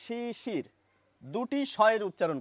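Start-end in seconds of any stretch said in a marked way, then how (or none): 0.0-0.7 শিশির with both স sounded as sh; pronunciation demo. Shown as a demonstration. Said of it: "উচ্চারণও হয়ে যাচ্ছে".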